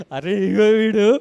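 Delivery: put-on slow, tearful child's plea; crying. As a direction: choke it out through tears. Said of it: "ఏడుస్తూనే ఉన్నా నేను". (ఏడుస్తూ పిలవడం)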